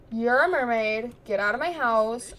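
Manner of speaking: ordinary delivery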